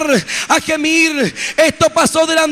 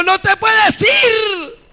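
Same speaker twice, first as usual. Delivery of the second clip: bishop's urgent cry; 7 semitones higher, much slower than usual. Retold or imitated. Bishop crying out to stop him leaving his body, pleading. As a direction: hold him back with a desperate cry.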